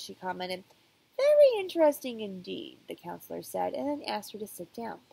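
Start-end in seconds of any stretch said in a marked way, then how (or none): 1.2-2.8 higher, louder pondering doctor; emphatic doctor. Acted. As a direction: push it up, more intrigued. Counselor repeats, more emphatic.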